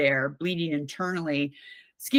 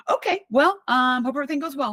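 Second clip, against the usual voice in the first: high, bright mock-cheerful mom voice, louder, rushing the end; imitating mom; 8 semitones higher, 5 dB louder, faster than usual. Daughter imitating her mom's breezy answer to her Spain plan, high and cheery. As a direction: play the unbothered mom, chirpy and breezy.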